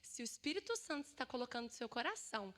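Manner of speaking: normal talking voice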